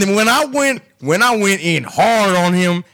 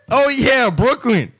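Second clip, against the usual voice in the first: ordinary talk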